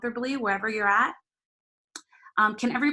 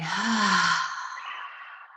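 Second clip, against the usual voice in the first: release sigh. Shown as a demonstration. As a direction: let it all go with a sigh. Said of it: "Release with sigh".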